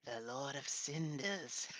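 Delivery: put-on soft, slow old crone whisper; Dark Souls old lady. To his friend, doing the Dark Souls old lady, slow soft crone. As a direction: breathe it like an ancient hag. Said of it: "that old lady? in Dark Souls".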